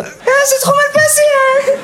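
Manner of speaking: squeaky high-pitched mock customer, laughing. Customer leaving the fitting room, squeaky high mock voice. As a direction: play a silly guy squealing out of the fitting room. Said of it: "T'as déjà vu un mec, il est sorti comme ça?".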